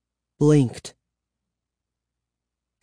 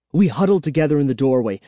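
usual speaking voice

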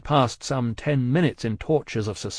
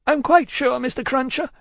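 high, hurried woman's voice; distraught genteel woman; 14 semitones higher, 4 dB louder than usual. A narrator's reading. Miss Pross cutting short Cruncher's vows, anxious. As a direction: pitch it far up, anxiously polite.

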